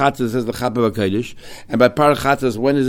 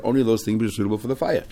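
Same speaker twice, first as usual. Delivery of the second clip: usual speaking voice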